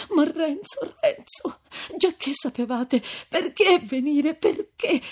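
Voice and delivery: high, plaintive female voice; distraught appeal near tears; anguished pleading. A narrator's reading. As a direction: plead desperately, pitch soaring. Lucia pleads with Renzo.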